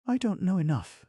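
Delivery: very high woman's character voice; Kate's voice. A narrator's reading. Kate answers eager young Mirabal, very high voice. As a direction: answer in a voice pitched right up high.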